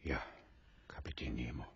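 barely breathed, soft and low; a hushed whisper at a deathbed. Acted. At Nemo's deathbed, whispering his name softly. Dying captain's farewell.

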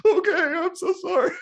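high, loud mock cry; mock sobbing apology. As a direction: whimper it like a beaten kid. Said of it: "I probably would be like".

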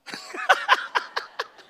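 bright unvoiced burst of laughter; high delighted laugh. Real, not acted. Delighted laugh at boy's prayer.

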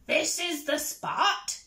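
slow, lightly voiced pirate chief; pirate captain. Captain tells his crew where to dig, slow pirate voice. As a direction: announce it slowly like a captain.

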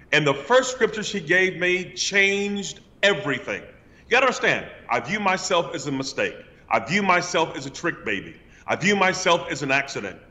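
ordinary delivery

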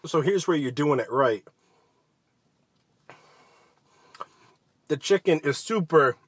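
speaking with food in mouth; muffled chewy words; talking while chewing. Real, not acted. Seen 0: Bit sandwich at -7; chewing, cheeks puffed, between words until ~6.7.

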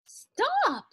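very high, loud storybook yell; old woman's yell. A narrator's reading. Old woman calls after the runaway musubi man, high yell. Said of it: "yelled the little old woman".

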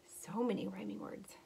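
soft whispered aside. Real, not acted. Teacher whispering an aside to the children, softly. Aside to kids during story.

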